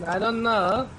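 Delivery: put-on light Borat accent, rising at the end; Borat-style accent. Friend riffing along in a Borat accent.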